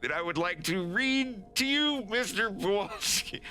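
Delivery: high-pitched, desperate pleading; frantic high plea to a captor; begging. Acted. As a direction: beg nervously to be heard. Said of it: "he's just, like, begging".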